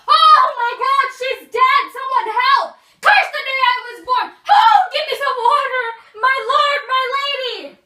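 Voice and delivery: loud, high, frantic screaming; panicked yelling. Acted. Nurse finds Juliet dead, screaming. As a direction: scream it out for help. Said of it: "Why are you yelling?".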